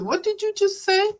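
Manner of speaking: hearing the divorce is final, high and startled; stunned disbelief. Retold or imitated. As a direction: snap round in disbelief.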